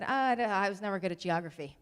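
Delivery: loud, defeatist parent excuse; fixed-mindset parent. Acted. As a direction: give up out loud, playing the overwhelmed parent. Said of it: "that's what my fixed mindset parenting voice sounds like".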